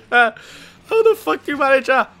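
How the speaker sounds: high, slowed words through laughter; laughing disbelief. Real, not acted. Gamer to friend shot off his bike, laughing incredulously. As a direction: crack up while asking.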